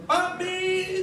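put-on high, drawn-out child's call; imitating a child welcoming a parent; child's glad greeting. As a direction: call out to daddy like an excited kid. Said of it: "yang sulung nyambut saya gitu".

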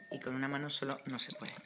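normal talking voice

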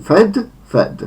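fed with a plain short e; first fed up, second down, both with an ordinary e; normal e. Shown as a demonstration. Teacher models second and third forms, plain-e fed. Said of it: "potem normalne E".

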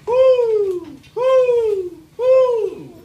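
loud high owl hooting. Acted. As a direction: hoot like an owl. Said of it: "and hooted".

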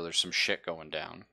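ordinary talk, nothing marked

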